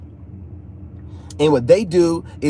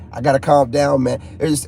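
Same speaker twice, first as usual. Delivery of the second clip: ordinary delivery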